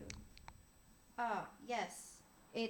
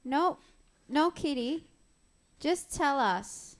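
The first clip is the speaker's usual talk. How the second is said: higher and louder, firm insistence; insistent demand; 5 semitones higher, 6 dB louder than usual. Acted. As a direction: cut in firmly and press for the truth. Player in character presses Victor.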